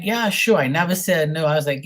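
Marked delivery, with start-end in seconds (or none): 0.0-0.6 high, bright fake agreement, higher and louder; mock eager yes. To the scammer, pretending to go along: high mock eager yes. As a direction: sing out a fake yes like you're totally in.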